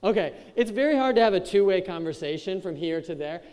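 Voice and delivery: usual speaking voice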